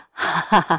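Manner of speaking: loud laugh in character; acted hearty laugh. Director bursts out laughing.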